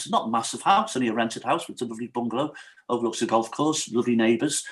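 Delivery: ordinary talk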